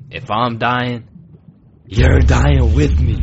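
loud vengeful shout, building to the end; pause, then blast the threat slowly; angry resolve. Acted. Drowning boy deciding to take Ben down, angry shout. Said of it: "out of sheer anger".

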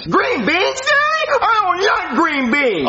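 put-on high-pitched child's whine; whiny protest. Child balking at dad's green beans, whiny protest.

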